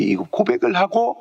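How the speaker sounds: usual speaking voice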